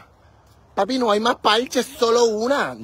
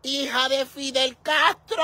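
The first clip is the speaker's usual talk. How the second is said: high mock-insult yell; playful shout; 7 semitones higher than usual. Real, not acted. Calling her partner a joking insult, high playful shout.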